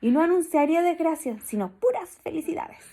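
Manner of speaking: lightly voiced proud mouse; boastful mouse. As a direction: brag sweetly. Mouse character keeps boasting.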